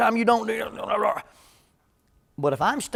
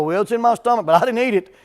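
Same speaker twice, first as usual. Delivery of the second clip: put-on a touch higher and louder, a kid's smug protest; sly kid's excuse; 4 semitones higher, 8 dB louder than usual. Boy protests to his parent that he never ate it, mildly mocking kid voice. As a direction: plead like a kid caught on a technicality.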